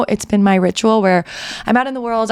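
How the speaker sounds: normal talking voice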